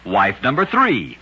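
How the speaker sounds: plain reading voice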